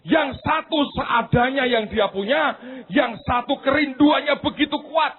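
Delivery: high, loud sermon climax; preaching at full force. Acted. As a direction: preach it out with fire. (khotbah berapi-api, keras dan tinggi)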